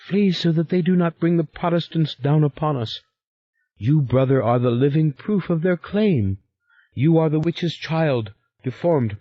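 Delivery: urgent, high pleading sister; frantic sister warning. A narrator's reading. Sister urging her brother to flee the valley, high and frantic.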